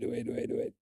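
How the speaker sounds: whispered under breath; whispering. Real, not acted. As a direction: whisper it to yourself. Fiddling with stream setup.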